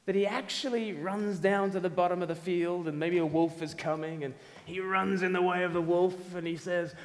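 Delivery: ordinary delivery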